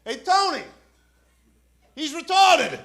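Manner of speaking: high, strained call to a colleague. Retold or imitated. Imitating cop calling to partner from van door, high holler.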